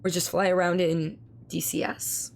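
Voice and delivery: ordinary talk, nothing marked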